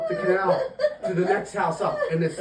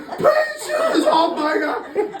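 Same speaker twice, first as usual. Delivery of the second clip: very high, hammed-up shriek; mock yelling owner; 4 dB louder, much slower than usual. Storyteller as owner yelling while his dog bites rescuer, mock shriek. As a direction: scream the dog's name in mock panic. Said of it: "this guy's yelling".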